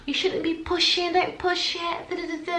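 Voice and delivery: high, light nurse voice telling her off; mock nurse. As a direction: chirp it like a fussy midwife. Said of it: "and they're like".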